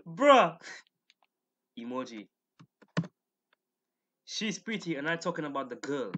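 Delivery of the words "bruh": bursting out laughing; laugh, then a loud bruh. Real, not acted. Laughing at the misspelling.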